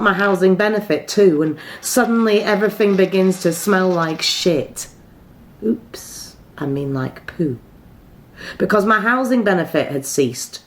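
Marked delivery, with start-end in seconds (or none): 5.6-7.6 soft, mock-embarrassed aside; hushed comic oops; sheepish aside. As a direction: drop the voice for a coy self-correction. Mock self-correction after swearing on stage.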